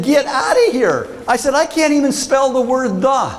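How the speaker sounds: high, incredulous protest; comic disbelief. Retold or imitated. Student disputes the professor's praise, high incredulous protest. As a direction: protest high as if it can't be true.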